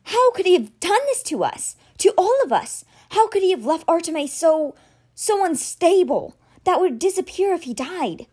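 put-on betrayed boy raging at his mentor; quavering anger. A narrator's reading. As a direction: accuse and grieve at once. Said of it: "his voice quavered with pent-up anger".

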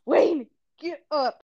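retold mom call. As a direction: call the kid up off the floor, drawn out. Mother retelling calling her child.

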